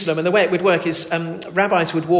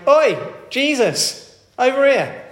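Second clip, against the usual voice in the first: slow, much higher, louder hail; calling out; 8 semitones higher, 6 dB louder, slower than usual. Retold or imitated. Blind man hails Jesus across the crowd, calling out loud. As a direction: shout across the crowd to catch his attention. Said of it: "he called out".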